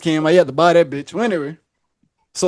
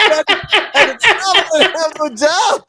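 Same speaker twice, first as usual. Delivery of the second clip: laughing; 10 semitones higher, slower than usual. Retold or imitated. Admitting no job while laughing.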